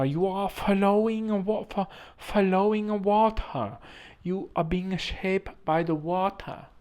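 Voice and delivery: very slow, high mock Chinese accent. Speaker retrying the water saying, mock Chinese accent. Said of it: "This is my Chinese accent".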